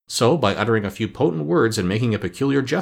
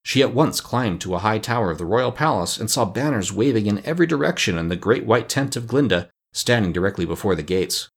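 plain reading voice

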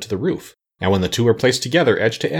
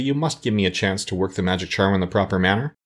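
usual speaking voice

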